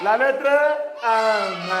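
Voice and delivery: very drawn-out "la lettre M"; slow letter drill. Shown as a demonstration. Teacher leading class on the letter, slowly drawn out. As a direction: stretch each word of "la lettre M" for repeating.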